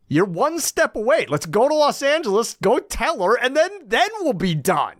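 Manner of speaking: high, eager persuading voice; Penny pleading. Retold or imitated. Penny urging Desmond to go to Los Angeles, high and eager. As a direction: coax him in a high, lively voice. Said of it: "Penny opts him in".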